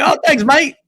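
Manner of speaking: high, loud hammed-up Australian accent; a jokey Australian put on for a quoted celebrity; mock Australian accent. Retelling the actor's reply in a mock Australian accent. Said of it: "He's not Australian".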